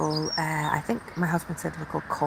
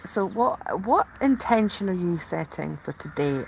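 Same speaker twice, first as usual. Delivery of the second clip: ordinary talk, nothing marked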